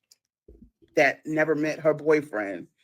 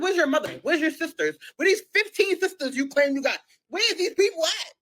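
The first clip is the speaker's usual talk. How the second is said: high, louder rapid-fire demands rising to the end; exasperated questioning; 12 semitones higher, 5 dB louder than usual. Retold or imitated. Girlfriend demanding his family step in, exasperated. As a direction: fire off questions, climbing in pitch.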